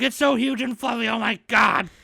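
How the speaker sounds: high, loud squeal of delight; overexcited gushing. Retold or imitated. Alien girl meeting a golden retriever, overexcited gushing. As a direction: gush like a hammed-up fangirl losing it.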